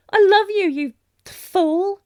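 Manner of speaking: a sudden shout of love that can't be held back; loud love confession. Acted. A prince confessing love to his knight, shouting it out. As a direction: blurt it out, high and loud, half exasperated.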